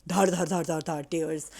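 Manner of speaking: loud playful dhar dhar of tears falling; gushing tears sound. Retold or imitated. Mimicking her tears pouring out, playfully loud. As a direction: spill it out like a tap.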